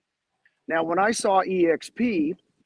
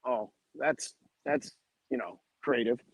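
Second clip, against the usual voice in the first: much softer, dry mock praise; quiet sarcastic creative; sarcastic aside; 6 semitones lower, 7 dB quieter than usual. Real, not acted. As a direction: undercut it under your breath.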